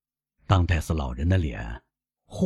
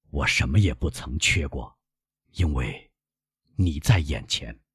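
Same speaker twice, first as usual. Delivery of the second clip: put-on tender old father; 4 semitones lower than usual. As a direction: gently reassure your son, voice lowered. Father tenderly reassures his son.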